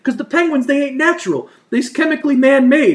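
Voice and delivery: high goofy Jersey guy explaining. Retold or imitated. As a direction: explain it like a dim tough guy who's sure. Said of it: "He's like".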